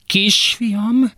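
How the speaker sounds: mother's strangled cry for her son; strained high call. Acted. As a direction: let it break upward.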